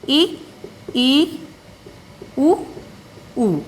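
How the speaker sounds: each vowel held and spoken apart; vowel demo. Shown as a demonstration. Teacher sounds out the vowels for her class, slowly. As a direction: sound out ई and उ slowly, one by one, for students. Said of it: "क्या आ जाता है आपका".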